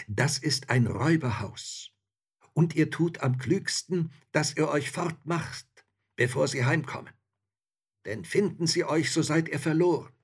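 put-on old woman urging them to flee; warning old woman. A narrator's reading. Old woman warns the men.

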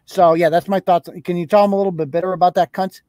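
ordinary talk

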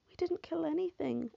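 high, tearful and pleading; a terrified sister begging through tears; weeping plea. A narrator's reading. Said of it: "Elaine wept".

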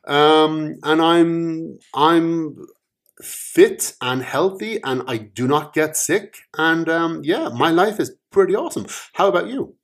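boastful brag. Acted. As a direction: show off your great life. First guy boasts about his life.

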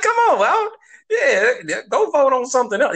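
laughing exasperation. Retold or imitated. To a friend, mocking the ballot vote, laughing exasperated. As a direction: laugh through an imitated complaint. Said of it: "I'm like".

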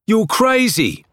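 high, loud flat dismissal; blunt retort. A narrator's reading. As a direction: snap it back dryly.

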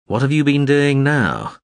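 slow, loud, exasperated question; Gurgay. A narrator's reading. Gurgay questions the drone, slow and loud. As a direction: demand it of the little drone. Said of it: "Gurgay asked Morin Skell".

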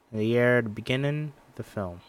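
ordinary talk

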